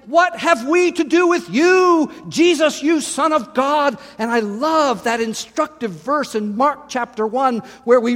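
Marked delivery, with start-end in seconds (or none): 0.1-4.0 demons shouting. Acted. Demons cry out at Jesus.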